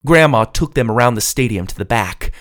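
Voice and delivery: plain reading voice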